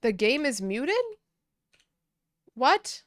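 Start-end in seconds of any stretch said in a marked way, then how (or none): 2.5-3.0 surprised. Real, not acted. Learns the game was muted.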